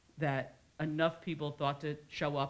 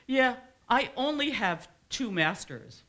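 slow, high, loud mock bragging; mock boast; 9 semitones higher, 7 dB louder, slower than usual. Speaker imitates someone boasting of two masters, slow mock brag. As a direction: draw it out loudly, showing off.

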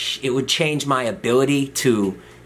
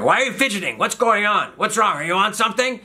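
high-pitched mock interrogation; imitated irritated grilling; 10 semitones higher than usual. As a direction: fire questions like an exasperated doctor. Doctor grilling fidgety patient.

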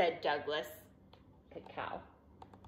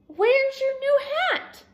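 high, loud exclamation; dismayed parent; 5 dB louder, slower than usual. A narrator's reading. Parents greeting bedraggled Douglas at home, high surprised voice.

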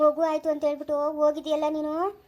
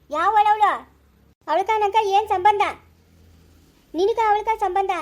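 angry shout; 4 semitones higher, 5 dB louder, slower than usual. Acted. Wife shouts at husband in quarrel.

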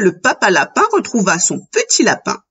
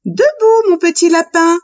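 high, singsong mother voice; mother's wake-up call; 8 semitones higher than usual. A narrator's reading. Mother to her little rabbit in the morning, high wake-up call. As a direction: call it out high, as a mum waking her child.

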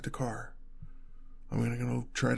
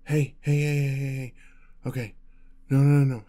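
urgent alarm; 3 semitones higher, 8 dB louder than usual. Acted. Boyfriend urging partner to pay close attention, urgent alarm. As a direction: cut in urgently to get their attention.

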